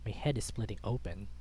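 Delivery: ordinary delivery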